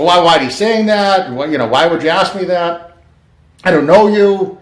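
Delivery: mock a rattled, defensive reaction; retold freak-out. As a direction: act out someone freaking out at a stranger. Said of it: "like, freaking out".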